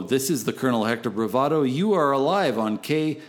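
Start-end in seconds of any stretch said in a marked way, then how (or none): none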